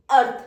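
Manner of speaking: अर्थ said loud and crisp as English "earth", with an aspirated th; word pronounced clearly. Shown as a demonstration. Teacher reading the decoded outline aloud to students, saying अर्थ loudly like "earth". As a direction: read out अर्थ firmly, like "earth". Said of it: "बोलते अर्थ है".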